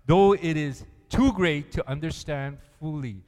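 loud, building sermon reading; preaching. Acted. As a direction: proclaim the verse to the church. Preaching the scripture.

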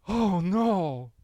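sharp gasp, then a mock worried oh no; a light mock gasp of dismay; alarmed gasp. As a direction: imitate a panicked worker hearing bad news. Reacting to layoff gossip.

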